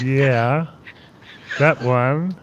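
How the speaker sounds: lightly put-on odd voice; a hint of the scary character voice; creepy put-on voice. Co-host replying to a remark about the voice, in that odd voice. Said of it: "heard you do that voice".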